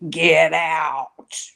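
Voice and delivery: low, guttural ghostly growl of a command; deep guttural voice. Retold or imitated. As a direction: recreate the eerie voice from the wall, deep and rough. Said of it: "I'm gonna try to recreate what it sounded like".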